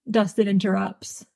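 usual speaking voice

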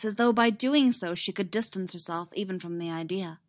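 ordinary delivery

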